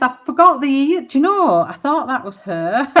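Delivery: loud, high outburst breaking into laughter; laughing dismay. Real, not acted. Crafter realising she forgot the ears, real laughing dismay. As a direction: exclaim it high and loud, amused at your own slip.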